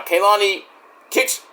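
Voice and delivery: ordinary talk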